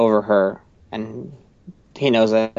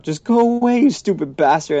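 much higher, hammed-up shouted dismissal; mock angry yell; 8 semitones higher, 4 dB louder than usual. Owner chasing away the dog they can't keep, mock angry. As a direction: shout it like driving off a stray.

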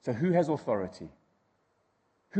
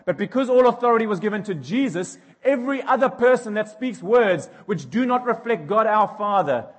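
louder, higher impassioned preaching; preaching at full force; 6 semitones higher, 8 dB louder than usual. Acted. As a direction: drive the point home to the congregation. Preaching on authority.

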